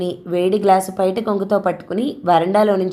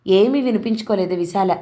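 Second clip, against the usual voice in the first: ordinary delivery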